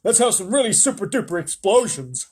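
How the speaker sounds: high, loud pleading general's voice; army chief egging on the president; eager army chief. A narrator's reading. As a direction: wheedle for explosions. Army chief begging for explosions.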